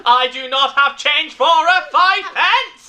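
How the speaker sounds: slow, loud robotic Dalek voice; flat, mechanical angry robot. Pretend. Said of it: "Why is it a Dalek?".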